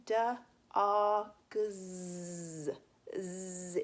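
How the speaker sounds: "Duh, ah, g" then a buzzing "z, z"; sounding out dogs. Shown as a demonstration. Teacher sounding out "dogs" for pupils, ending on a buzzing z. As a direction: sound out the word, then buzz the z. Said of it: "Listen to this".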